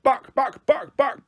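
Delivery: high, sharp word-barks; excited little dog barking. A narrator's reading. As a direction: say each bark like a yap. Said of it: "and barked".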